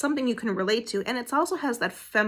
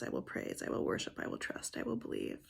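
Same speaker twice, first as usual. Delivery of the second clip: whispered vow; 14 semitones lower, 12 dB quieter than usual. Real, not acted. Repeats sermon's vow.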